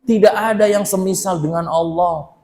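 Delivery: ordinary talk, nothing marked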